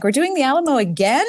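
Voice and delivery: high, sing-song weary resignation; hammed-up tired parent groaning along; mock exasperation. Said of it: "I'm like".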